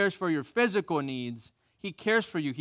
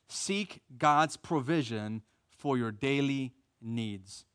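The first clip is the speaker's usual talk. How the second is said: slow emphatic repeat; 4 semitones lower, much slower than usual. Shown as a demonstration. Preacher repeating the point to the church, slow and deliberate. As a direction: let each word land as he repeats the point. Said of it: "repeated for emphasis".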